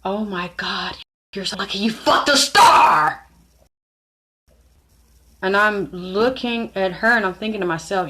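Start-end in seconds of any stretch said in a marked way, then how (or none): 0.0-3.3 cheering it on. Retold or imitated. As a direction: gush like a star-struck friend, rising to a shout. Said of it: "like, cheering it on".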